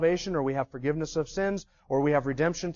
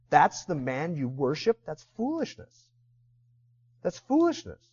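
scoffing Gentile. Retold or imitated.

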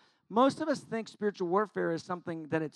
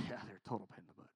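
muttered agreement; 11 semitones lower, 23 dB quieter, much faster than usual. Retold or imitated. Agreeing with the devil.

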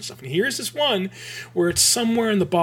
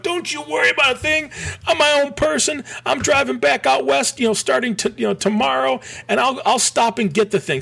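mock defiant reply; 9 semitones higher than usual. His own retorted reply.

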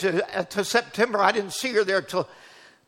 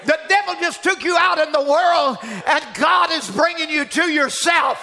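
fervent preaching; 7 semitones higher, 4 dB louder than usual. Retold or imitated. Preacher on the phone at night urging her home, fervent. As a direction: preach hope into her with fire.

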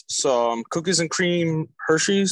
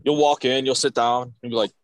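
normal talking voice

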